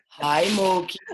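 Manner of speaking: loud, affectionate dog greeting; cooing to puppy. Real, not acted.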